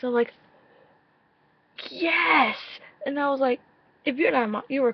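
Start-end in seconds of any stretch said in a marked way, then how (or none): 1.9-2.6 high, cheering yes; excited yes. Retold or imitated. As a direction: cheer it like your idol won. Cheering at the video.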